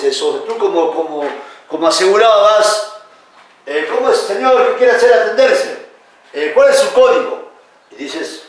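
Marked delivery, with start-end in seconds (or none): 3.7-7.5 raised, higher voice of a clinic clerk questioning a patient; acted clerk. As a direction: play the counter clerk demanding the code.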